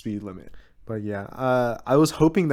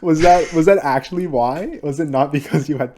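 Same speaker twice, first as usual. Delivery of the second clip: incredulous; 5 semitones higher, 9 dB louder than usual. Real, not acted. Teasing disbelief at the excuse.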